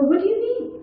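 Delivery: voice going up. Retold or imitated. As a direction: shoot the pitch up defensively. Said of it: "obviously your voice goes up".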